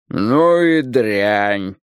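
slow, mildly crestfallen remark; dismayed. A narrator's reading. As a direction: draw it out with a touch of dismay. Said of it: "сокрушенно заметило первое лицо". (слегка сокрушённо, протяжно)

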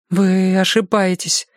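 slow, high-pitched protest without conviction; unconvinced insistence. A narrator's reading. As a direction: draw it out, insisting but not believing it. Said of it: "но без убеждённости в голосе".

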